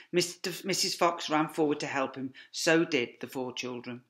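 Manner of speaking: usual speaking voice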